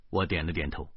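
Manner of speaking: plain reading voice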